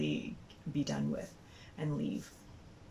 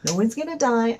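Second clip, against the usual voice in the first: higher, loud protest; exasperated retort; 7 semitones higher, 10 dB louder than usual. Retold or imitated. Mom argues back at worried cashier, exasperated. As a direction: raise pitch and volume, fed up.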